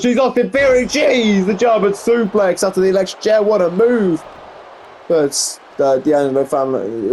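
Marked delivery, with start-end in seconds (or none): 0.0-4.2 excited. Real, not acted. Big suplex on live commentary.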